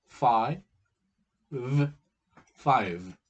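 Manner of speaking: Five said loud and drawn out; demo word. Shown as a demonstration. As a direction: stretch Five to show two sounds. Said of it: "two sounds".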